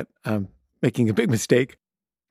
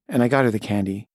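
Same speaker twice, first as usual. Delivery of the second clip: ordinary talk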